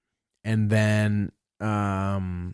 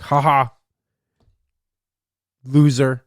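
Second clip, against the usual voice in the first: mocking taunt; 6 dB louder, slower than usual.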